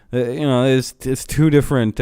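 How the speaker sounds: usual speaking voice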